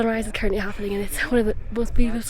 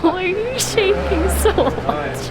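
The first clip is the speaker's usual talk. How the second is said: high, slow laughing question; 8 semitones higher, much slower than usual. Real, not acted. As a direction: ask it through giggles. Laughing at shivering friend.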